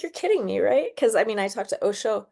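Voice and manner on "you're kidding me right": mock disbelief. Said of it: "And I was just like".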